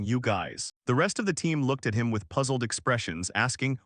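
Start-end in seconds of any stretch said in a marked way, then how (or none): none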